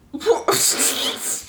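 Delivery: high breathy giggle; burst of breathy laughter. Real, not acted. Reading a silly review to viewers, breathy high laugh. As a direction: crack up at the review.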